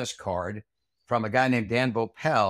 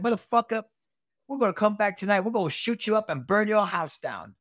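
high-pitched, heated mimicry of a threat; imitated gang threat; 10 semitones higher than usual. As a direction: play the hothead threatening payback, pitched way up. Acting out gang member's threat.

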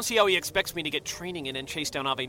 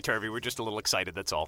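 ordinary talk

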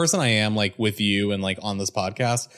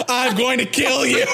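much higher and louder; mock shouted threat; 14 semitones higher, 5 dB louder than usual. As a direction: yell it with comic fury.

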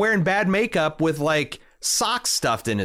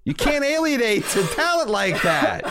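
laughing so hard it nearly turns to crying; higher, laugh-choked delivery; 9 semitones higher than usual. Real, not acted. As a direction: wheeze the line through tears of laughter. Laugh-crying at own joke.

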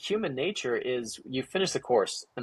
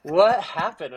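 higher, louder, laugh in the voice; retold laughing question; 7 semitones higher, 5 dB louder, slower than usual. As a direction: imitate someone asking through laughter. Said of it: "she just starts laughing".

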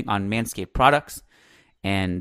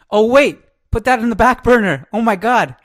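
hammy ad read; 11 semitones higher, 7 dB louder than usual. Acted. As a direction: ham up the sponsor plug. Hammed-up switch into ad read.

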